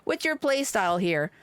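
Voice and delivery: ordinary talk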